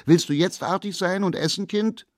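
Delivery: laut und sehr hoch gerufen, Kind steigt noch; rufende Mutter. Acted. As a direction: ruf ungeduldig durch die Tür. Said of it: "rief durch die Tür". (Mutter ruft zum dritten Mal laut nach dem Kind)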